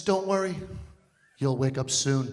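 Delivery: lightly voiced sinister doctor, low; a hint of menace, low; creepy doctor. Doctor soothing patient, a touch sinister. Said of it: "says the creepiest shit".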